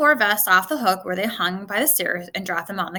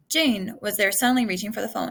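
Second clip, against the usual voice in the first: ordinary delivery, nothing marked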